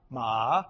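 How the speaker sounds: MA very low and dipping; third tone. Shown as a demonstration. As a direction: drop ma to the bottom of the voice. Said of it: "it means four different things".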